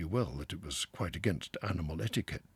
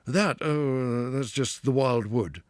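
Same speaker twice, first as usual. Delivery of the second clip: a touch louder, brushing it off; short, dismissive; 7 semitones higher, 10 dB louder, slower than usual. A narrator's reading. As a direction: cut the subject off curtly. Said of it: "said the rat shortly".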